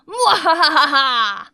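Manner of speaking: slow, loud cackling mwahaha; evil villain laugh. Acted.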